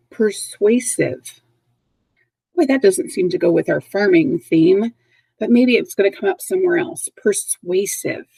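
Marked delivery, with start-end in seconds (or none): 0.1-1.4 said slowly, syllable by syllable for learners; slow model word. Shown as a demonstration. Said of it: "the next word". 7.2-8.3 persuasive drawn out slowly and a bit softer; slow model word. Shown as a demonstration. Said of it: "How well do you know persuasive?".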